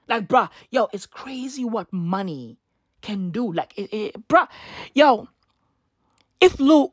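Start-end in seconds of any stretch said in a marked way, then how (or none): none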